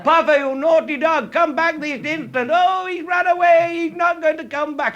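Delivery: high loud falsetto scolding the dog; mock posh scolding. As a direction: ham up the fussing owner. Voices the posh owners.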